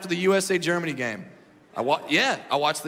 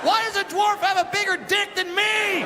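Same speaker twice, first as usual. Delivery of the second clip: outraged shout; 13 semitones higher than usual. Acted. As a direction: scream it like a robbed robber. Mugger discovering the photos.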